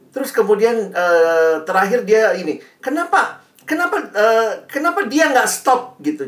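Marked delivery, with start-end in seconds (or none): 2.8-6.0 whiny complaint. Retold or imitated. Imitating student's complaint.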